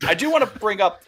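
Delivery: talking through a burp; words pushed out on a belch. Real, not acted. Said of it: "talking through burps now".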